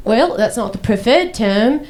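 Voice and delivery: slow, prim Australian doctor; imitated Australian accent. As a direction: correct them slowly in an Australian accent. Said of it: "he was Australian".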